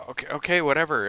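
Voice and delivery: giving in to the cashier, high and exasperated; fed-up whatever. Retold or imitated. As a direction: give up with a huff. Retold giving up.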